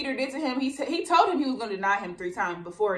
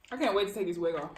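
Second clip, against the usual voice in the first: usual speaking voice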